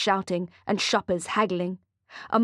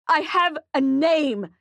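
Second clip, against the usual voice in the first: high, slow, desperate shout; 7 semitones higher, 4 dB louder, slower than usual. Acted. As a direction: cry out, outraged. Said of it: "shouts Zach".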